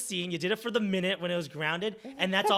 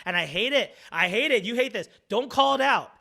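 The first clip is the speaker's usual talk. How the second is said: higher, much louder, building protest; exasperated rant; 7 semitones higher, 10 dB louder than usual. Real, not acted. Improviser ranting at scene partners who call out dropped accents, exasperated.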